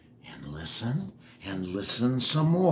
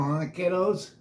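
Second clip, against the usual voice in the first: plain reading voice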